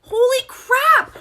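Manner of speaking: high, drawn-out shocked cry; stunned exclamation. Retold or imitated. Yoga beginner reacting to a pose, stunned. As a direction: stretch both words out in disbelief.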